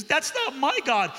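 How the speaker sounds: put-on defiant girl. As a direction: refuse it as the teen girl. Girl rejects his answer.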